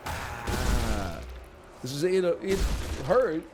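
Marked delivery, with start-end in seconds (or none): 0.1-1.2 low groan. Shown as a demonstration. Showing chat the low "Ah" he really made at the sink. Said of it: "but I was going".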